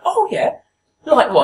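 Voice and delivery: put-on very high, loud disbelieving old woman; sceptical old lady. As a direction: imitate an old lady who doesn't believe it. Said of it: "And she didn't believe me".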